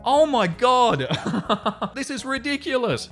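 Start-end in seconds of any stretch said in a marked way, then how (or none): 0.1-1.1 drawn-out disbelief breaking into laughter; incredulous laughing. Real, not acted. Incredulous reaction to the husband's reply. 1.1-1.9 short burst of disbelieving laughter; incredulous laugh. Real, not acted. Laughs at the husband's reply.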